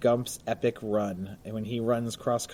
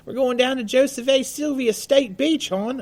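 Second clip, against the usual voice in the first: put-on Baltimore accent; 13 semitones higher, 4 dB louder than usual. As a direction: ham up a Baltimore local accent. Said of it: "That was my Baltimore accent".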